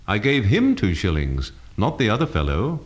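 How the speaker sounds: "him" carries the main stress. Shown as a demonstration. Teacher showing emphasis changes meaning, stress on "him". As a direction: hit the word "him" to single out that person. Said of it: "different readings of a simple statement".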